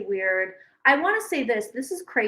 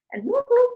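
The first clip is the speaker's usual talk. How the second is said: high whooping bird call, mimicking turkeys; 12 semitones higher, 5 dB louder than usual. Mimicking the turkeys staring through the window, high whoops.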